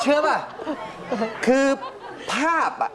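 high, laughing retelling; laughing disbelief. Retold or imitated. Wife's quote, raised and laughing.

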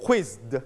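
"quizzed" in one syllable, buzzed Z into a released D; voiced D ending. Shown as a demonstration. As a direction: finish "quizzed" on a voiced D after the buzz. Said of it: "when you put E D you pronounce the". (ออกเสียง "quizzed" จบด้วยเสียง ด ต่อจากเสียง Z)

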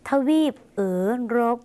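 เอ๋อรบ with a tongue-rolled r; Lao rolled r. Shown as a demonstration. Teacher repeating the Lao word for Europe, rolled r. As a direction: roll the ร in เอ๋อรบ after a drawn "oe". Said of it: "ใช้รอราชสีห์หันลิ้นนะคะ".

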